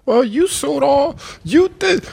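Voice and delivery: high, mocking voice of a judging cop; imagined judgmental cop, high and mocking. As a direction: sneer it up high as the cop he wasn't. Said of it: "He didn't say".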